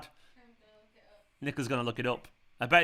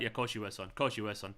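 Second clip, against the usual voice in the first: mock cop; 5 semitones lower, 7 dB quieter, faster than usual. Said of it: "old-fashioned cop who's on the beat".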